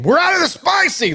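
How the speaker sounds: high, snapping mock worker; a hammed-up rude cashier. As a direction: snap it back like a rude clerk.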